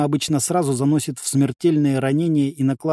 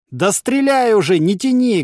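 raised, higher, impatient call; loud demand; 7 semitones higher, 5 dB louder than usual. A narrator's reading. Fighter dares the enemy line to shoot, loud demand. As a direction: shout back impatiently. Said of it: "громко попросил я".

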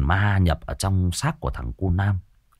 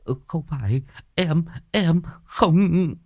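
scared stammer; 8 semitones higher, slower than usual. A narrator's reading. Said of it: "mặt tái xanh tái xám".

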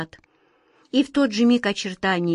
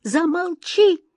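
high, drawn-out cry of terror; horrified cry; 10 semitones higher, slower than usual. A narrator's reading. Wizard silencing the genie as he reveals secrets, horrified cry. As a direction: cry out to stop him, high and stretched. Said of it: "в ужасе крикнул волшебник".

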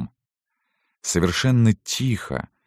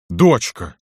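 raised, loud exclamation, half complaint; reproachful cry; 5 semitones higher, 5 dB louder than usual. A narrator's reading. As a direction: cry out to your daughter in reproach. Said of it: "вскрикнул он, обратившись к Галине не то с жалобой, не то с упрёком". (вскрик с жалобой и упрёком)

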